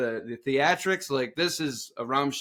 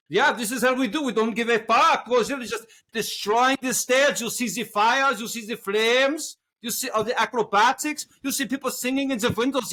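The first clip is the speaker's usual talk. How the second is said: put-on French accent; 9 semitones higher than usual. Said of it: "was that a French accent?".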